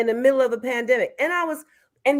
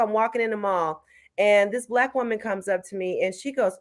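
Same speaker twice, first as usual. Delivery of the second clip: ordinary talk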